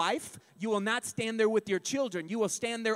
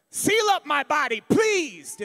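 pleading saint, hammed up; 4 semitones higher, 7 dB louder than usual. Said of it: "looking down going".

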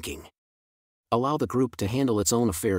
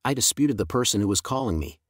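plain reading voice

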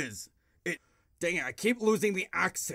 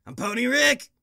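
louder, higher, a hammed-up burpy Rick shout; Rick Sanchez impression; 7 semitones higher, 9 dB louder than usual. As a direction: shout it like a manic mad scientist. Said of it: "I gotta go all Rick Sanchez a bit".

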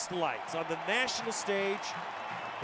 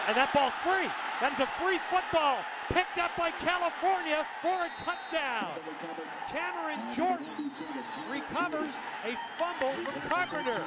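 excited shout; 5 semitones higher than usual. Real, not acted. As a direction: shout the loose ball. Fumble recovery touchdown.

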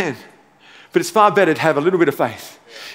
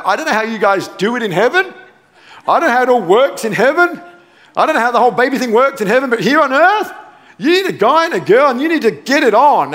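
higher, rising, mock-incredulous protest; bewildered Mary; 5 semitones higher, 3 dB louder, faster than usual. Preacher playing Mary questioning the angel, comically incredulous. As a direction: explain the obvious to an angel, increasingly high-pitched. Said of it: "just spare a thought for Mary".

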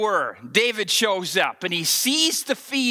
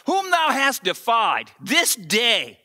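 quick, raised King James recital; scripture reading; 4 semitones higher than usual. Acted. Preacher reads David's words to Goliath aloud, proclaiming.

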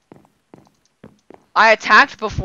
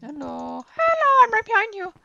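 creepy voice; 12 semitones higher than usual. Pretend. Creeping up on hidden friend.

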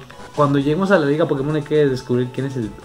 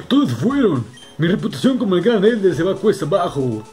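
put-on raised, pleading old leader; fretful elder voice; 4 semitones higher, 3 dB louder than usual. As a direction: beg for help anxiously. Voicing distressed old Elder.

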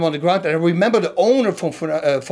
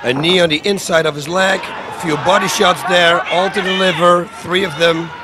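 high, loud play-by-play over the video; loud fight commentary; 4 semitones higher, 3 dB louder than usual. Real, not acted. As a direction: call each strike like a ringside commentator.

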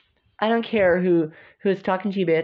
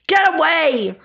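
shouted get away; 4 semitones higher, 6 dB louder, slower than usual. Acted. Pushing someone away in quarrel.

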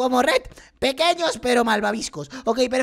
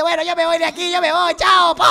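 louder, raised voice for a hammed-up goodbye; mock sad farewell; 3 semitones higher, 6 dB louder than usual. Gamer voicing a sad character leaving, mock sad. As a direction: play a sad exit loudly, breaking into a laugh. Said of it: "se pone muy triste".

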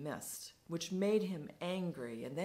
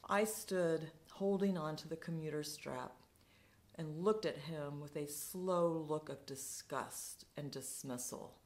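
plain reading voice

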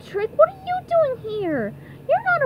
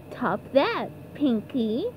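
low, quiet challenge; taunt; 8 dB quieter, slower than usual. Acted. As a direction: throw down a low-voiced dare. Contestant taunting his rival.

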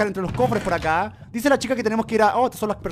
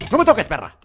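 angry shout; 8 dB louder than usual. Real, not acted. Enemy attacking.

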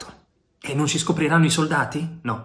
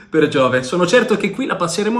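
loud, high, carefree exclamation; too loud; 5 semitones higher, 6 dB louder than usual. A narrator's reading. Yanez laughing in the hiding place, loud and high. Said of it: "Non parlare così forte".

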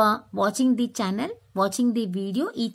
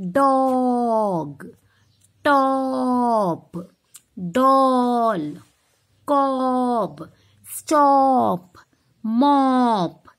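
each word loud, O stressed; teacher word list; 4 dB louder, much slower than usual. Shown as a demonstration. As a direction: read words loudly for the class. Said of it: "listen carefully the letter O sound".

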